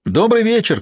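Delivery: high-pitched hail, little extra volume; calling out. A narrator's reading. Traveller hails two approaching riders, calling out. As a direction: call a greeting out to strangers on the road. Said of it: "крикнул он".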